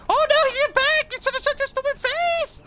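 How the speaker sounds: hammed-up, very high falsetto screaming; mock mass panic. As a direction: play a terrified movie crowd fleeing a monster. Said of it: "all of Japan is freaking out".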